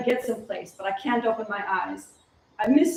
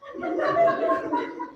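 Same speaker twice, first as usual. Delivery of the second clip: giddy laugh. Acted.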